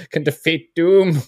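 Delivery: Doctor Doom vowing revenge on spoons; Doom villain declaration. Retold or imitated. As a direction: proclaim like a megalomaniac. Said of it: "It's like".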